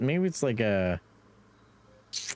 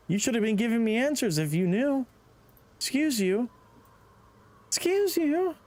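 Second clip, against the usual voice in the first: sassy mock voice; 13 semitones higher than usual.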